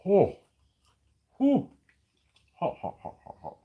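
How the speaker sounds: gasping through a hot mouthful; words puffed out around scalding food; mouth full of hot food. Real, not acted. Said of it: "it is quite hot".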